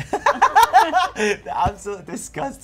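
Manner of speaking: loud, high, slow laughing outburst. Real, not acted. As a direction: crack up loudly while saying it. Cracking up at partner's disgust.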